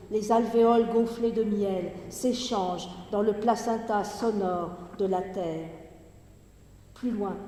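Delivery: projected, measured poetry recitation with falling line endings; Gedichtlesung. Acted. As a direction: declaim the verse in phrases set apart by pauses. Reads the poem aloud.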